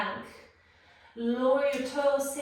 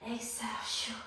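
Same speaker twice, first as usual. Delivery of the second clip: breathy whispered cue; whispered exhale; 9 dB quieter, faster than usual. Shown as a demonstration.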